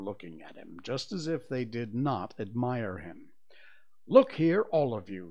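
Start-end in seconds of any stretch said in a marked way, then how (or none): 4.1-5.3 high, loud opening plea; entreating, starting high and dropping. A narrator's reading. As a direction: appeal to them all urgently. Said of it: "he said entreatingly".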